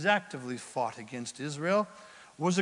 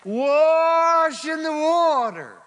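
high, loud, slow proclamation; booming command; 10 semitones higher, 9 dB louder, much slower than usual. Acted. God telling the believer what to do, slow booming call. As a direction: announce it like a voice from heaven. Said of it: "What is God saying?".

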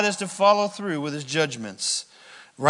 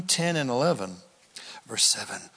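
normal talking voice

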